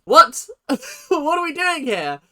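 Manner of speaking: shrieking high disbelief with a laugh; incredulous. Real, not acted. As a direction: react like it's absurd. Disbelief at wrong facts.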